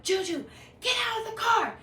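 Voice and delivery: screaming at her friend. Retold or imitated. Yelling at her friend to flee the fake Uber, frantic scream. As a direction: scream at Juju to get out now. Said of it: "I start cussing this man".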